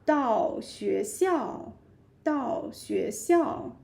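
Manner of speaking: "到学校" drawn out slowly; slow model phrase. Shown as a demonstration. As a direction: say it slowly for learners to copy. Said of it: "arrive school".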